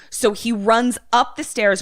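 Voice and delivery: usual speaking voice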